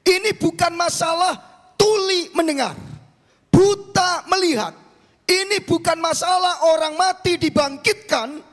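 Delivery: high, loud declamation with stretched key words; preaching at full force. Real, not acted. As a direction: proclaim it to the whole congregation, high and loud. (khotbah lantang berapi-api)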